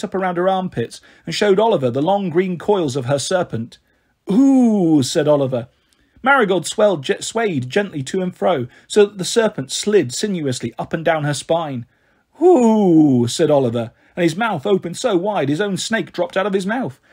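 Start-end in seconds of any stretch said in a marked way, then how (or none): 4.3-5.0 long, loud admiring ooh; wowed. A narrator's reading. Oliver sees the coils of the serpent, wowed. 12.4-13.3 loud, long ooh of amazement; wowed. A narrator's reading. Oliver watches the serpent slither, amazed.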